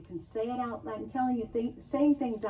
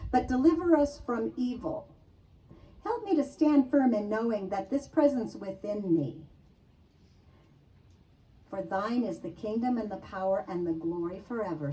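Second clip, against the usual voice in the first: prayer; 5 dB louder than usual. Acted. Reads out a prayer.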